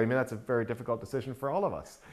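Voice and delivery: quick, softly delivered dry sarcasm; understated sarcasm, tossed off quickly; mildly sarcastic. Retold or imitated. Author teasing fourth graders about a vampire book, mildly sarcastic. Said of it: "I said, sort of sarcastically".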